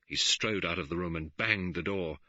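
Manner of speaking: ordinary delivery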